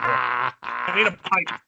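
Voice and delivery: hammed-up ship captain voice, low and slow; mock old captain. Playing the old sea captain.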